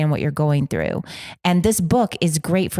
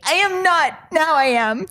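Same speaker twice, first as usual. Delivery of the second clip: 1 mock whiny denial; 10 semitones higher than usual. Mock denial of being victim.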